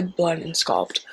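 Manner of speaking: normal talking voice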